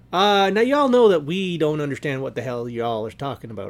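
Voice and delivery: ordinary talk, nothing marked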